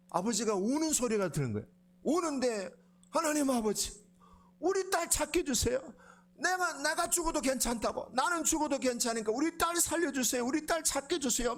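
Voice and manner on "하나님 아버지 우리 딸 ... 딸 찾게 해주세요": high, tearful pleading prayer; crying prayer. Retold or imitated. Father crying out to God for his lost daughter, tearful plea. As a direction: beg God through tears, voice pitched up.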